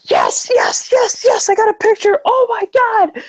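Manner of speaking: very high squealing yes; overjoyed squeal. Retold or imitated. Fan leaving the photo op, very high joyful squeal. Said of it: "I was just like".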